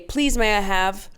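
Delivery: lightly mimicked British politeness; British accent. Teammates ordering food, in a light mock British accent. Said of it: "I don't know what accent that was".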